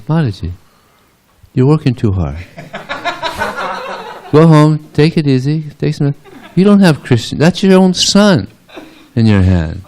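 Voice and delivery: high-pitched motherly correction; acted reassurance. Mother Yasoda telling gopi she's mistaken, reassuring.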